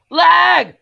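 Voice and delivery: loud, high cry of "Lag!"; high shout of annoyance; frustrated yell. Real, not acted. Lag spike, yelling.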